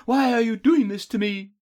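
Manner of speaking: high, loud plea; captive's high-pitched appeal to captor; pleading. A narrator's reading. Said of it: "with pleading eyes".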